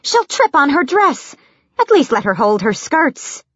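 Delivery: high-pitched fussy reproach; scolding. A narrator's reading. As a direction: scold him on her behalf. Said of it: "Philippa scolded".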